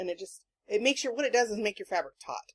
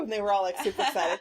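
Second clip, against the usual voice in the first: normal talking voice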